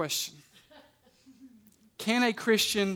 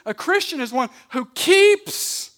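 "keeps" stretched long and pitched up; stress on keeps; 7 semitones higher, 6 dB louder than usual. Real, not acted. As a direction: lean hard on "keeps", drawing it out. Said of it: "I emphasize the word keeps here".

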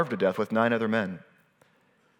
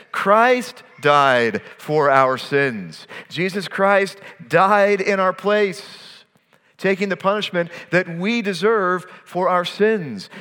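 preaching emphasis; 6 semitones higher, 3 dB louder than usual. Acted.

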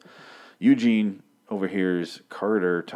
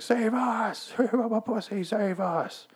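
squeaky, much higher panicked mock cry; mocking coward voice; much slower than usual. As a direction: ham up a terrified wimp begging. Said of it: "Carter's character is like".

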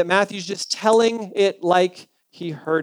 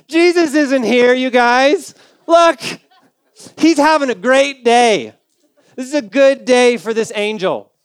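louder, bright cheery angel; cheerful angel; 2 semitones higher, 3 dB louder than usual. Acted. Angel calling to the women at the tomb, loud and cheerful. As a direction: play the angel thrilled to show the empty tomb.

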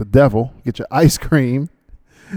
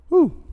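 sharp whew. Real, not acted. Reading a long ad aloud.

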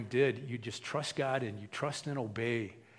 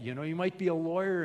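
ordinary delivery

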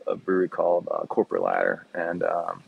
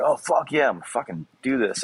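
higher-pitched eager self-quote; retold excitement; 7 semitones higher than usual. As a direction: quote yourself getting fired up. Quoting his own eager reaction.

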